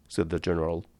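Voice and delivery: plain reading voice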